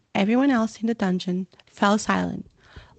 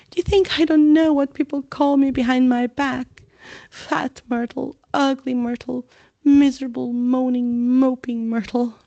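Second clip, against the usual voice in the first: high, loud tearful outburst; wailing self-pity; anguished; 5 semitones higher, 3 dB louder than usual. Acted. Myrtle lamenting her nicknames, anguished. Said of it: "anguished sobs".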